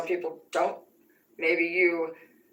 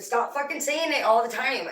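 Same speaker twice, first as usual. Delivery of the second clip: higher-pitched, exasperated comeback; retold angry protest; 6 semitones higher than usual. Daughter telling mom to stop, retold in heated exasperation. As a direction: replay yourself arguing back, voice raised in pitch.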